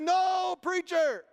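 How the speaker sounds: loud, high, drawn-out crowd answer; congregation's response. Retold or imitated. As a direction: holler back at the preacher. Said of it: "And they said".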